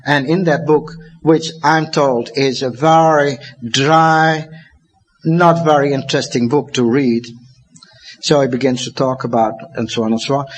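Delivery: usual speaking voice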